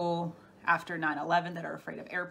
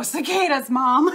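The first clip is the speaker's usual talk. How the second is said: a much louder laughing cry; 7 semitones higher, 12 dB louder than usual. Real, not acted. Reacting to chat about cicadas, loud laughing outburst. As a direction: blurt it out mid-laugh.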